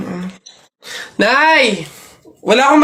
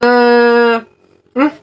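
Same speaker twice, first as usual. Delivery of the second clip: normal talking voice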